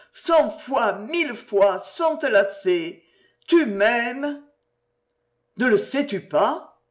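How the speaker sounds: high, impassioned plea; pleading woman lover. Acted. Narrator voices the woman pleading for love. (amante implorante, voix haute et passionnée)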